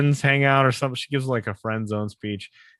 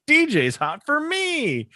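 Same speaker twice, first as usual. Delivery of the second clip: put-on much higher, slow, self-satisfied boy; smug Rusty; slower than usual. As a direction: stretch it out high like a cocky kid. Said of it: "And then Rusty thinks".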